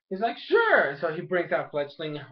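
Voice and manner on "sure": high-pitched agreeing reply. Retold or imitated. Acted quote of an agreeing reply.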